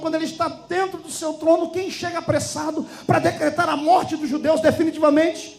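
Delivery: high, driving sermon narration; impassioned preaching. A narrator's reading. Preacher telling the congregation of the king's night, impassioned.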